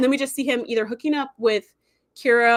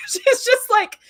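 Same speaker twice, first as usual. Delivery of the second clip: laughing; 14 semitones higher, 4 dB louder, slower than usual. Real, not acted. Laughing while retelling.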